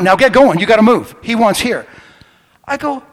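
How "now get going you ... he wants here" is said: loud, agitated retold order; freaking out. Ticket guy ordering a driver to move, freaking out. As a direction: act out a flustered official ordering someone off. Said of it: "he's freaking out".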